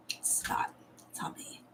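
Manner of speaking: barely voiced, very soft whisper; whispered aside. Real, not acted. Streamer joking aside to chat, whispered. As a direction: whisper it as a private disclaimer.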